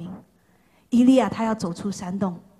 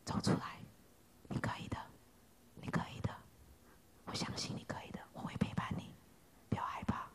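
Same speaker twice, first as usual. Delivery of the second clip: soft unvoiced coaxing; encouraging whisper; 20 dB quieter, faster than usual. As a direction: whisper them out of the dark. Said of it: "微小的声音". (轻声鼓励)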